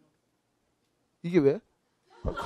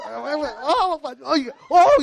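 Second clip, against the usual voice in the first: high, hammed-up flirty squeals; mock coquettish; 6 semitones higher, 4 dB louder, slower than usual. Woman flirting as her palm is read, mock coquettish squealing. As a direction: coo and giggle in exaggerated flirtation. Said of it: "막 교태를 흘려서".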